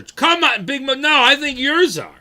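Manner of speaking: defending yourself with a high, loud squeak; high mock protest. Real, not acted. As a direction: squeal back at the chat in mock outrage. Playfully arguing with a chat viewer.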